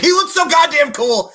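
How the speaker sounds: high, loud, starstruck gush; gushing fan. Retold or imitated. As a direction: squeal it like a smitten fan. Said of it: "And Nico's like".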